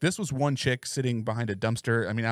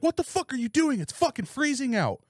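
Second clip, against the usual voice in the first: put-on homeless girl's outrage; 13 semitones higher than usual. Retelling the soaked girl yelling at him, high indignant cry. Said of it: "she's like".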